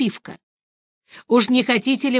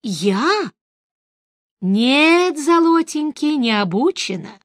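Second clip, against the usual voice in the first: put-on old woman voice; 6 semitones higher, slower than usual. Old woman answers Geralt.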